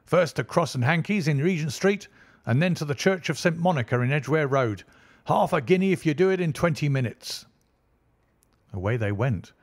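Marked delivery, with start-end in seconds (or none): 0.0-7.5 urgent shouting. A narrator's reading. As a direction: keep yelling at the driver to hurry. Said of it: "he shouted".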